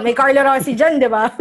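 loud joke through laughter; laughing. Real, not acted. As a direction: laugh while teasing. Joking aside, laughing. (tumatawang biro)

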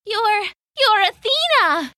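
high, loud, stammering in fear; frightened gasp. Acted. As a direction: recoil in terror. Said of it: "shrinking away from the goddess".

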